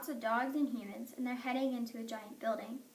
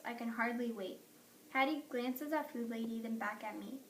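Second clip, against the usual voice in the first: ordinary delivery, nothing marked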